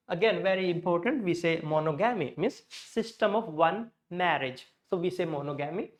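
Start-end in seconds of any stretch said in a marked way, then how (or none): 1.6-2.3 monogamy as mono-GAMMY; monogamy with full O's, "mo-no-gammy"; wrong MONOGAMY. Shown as a demonstration. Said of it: "that's not the way". 5.2-5.9 monogamy as mono-GAMMY; monogamy with full O's and "gam"; wrong MONOGAMY. Shown as a demonstration. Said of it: "that's not the way".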